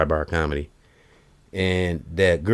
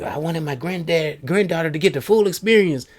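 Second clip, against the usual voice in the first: high, far-off put-on old man voice; higher, strained grandpa impression; grandfather quote; 11 semitones higher than usual. Retelling a comic's bit, grandpa voice pitched high. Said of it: "and he said".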